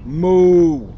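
ordinary delivery

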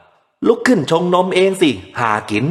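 put-on slow, high barked command at a baby; drunken snarl. A narrator's reading. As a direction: snarl it out slowly like a drunk father. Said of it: "มันจับเด็กเขย่าตะคอก".

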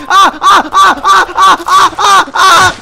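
loud high screaming ah ah; terrified laughing screams; panicked scream. Real, not acted. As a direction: scream while being eaten. Being eaten by monster.

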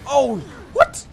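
high, loud burst of disbelief; shocked shout. Real, not acted. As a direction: yell out in sudden shock. Startled by the game.

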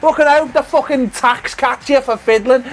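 mock outrage rant. Real, not acted. Loser ranting at his friend about Mario winning, mock furious. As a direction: rant like you've been robbed, half joking.

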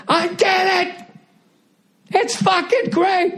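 very high, strained yell, words dragged out; exasperated mock scream. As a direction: act out someone driven mad by endless bliss. Fed-up heaven dweller snaps, acted.

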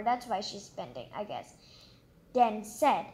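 ordinary delivery

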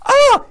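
high, loud squealing scream of fright; a soldier squeals in sudden terror; terrified shriek. Acted. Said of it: "shrieked aloud".

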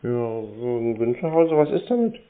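normal talking voice

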